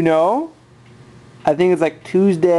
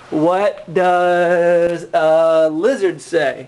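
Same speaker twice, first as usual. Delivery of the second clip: put-on very slow, drawn-out question to a toddler; much slower than usual. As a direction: drag every word for the baby. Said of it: "slow question to toddler".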